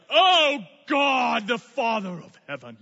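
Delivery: mock the show-off who prays to impress; hammed-up lofty praying tone; pompous prayer voice. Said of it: "a, an impressive prayer".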